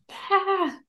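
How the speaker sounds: short high scream of release; frustrated scream. Retold or imitated. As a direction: let out a quick pent-up scream. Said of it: "just be like".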